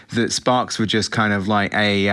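ordinary delivery

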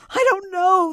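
high, loud howl of anguish; distraught wife wailing at full voice. A narrator's reading. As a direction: howl it out helplessly. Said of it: "the voice howled".

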